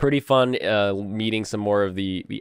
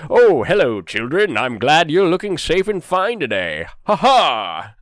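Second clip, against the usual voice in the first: higher and louder hammed-up gallant knight greeting; playing the big friendly paladin to kids; 7 semitones higher, 6 dB louder than usual. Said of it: "pretending to be like the big paladin knight".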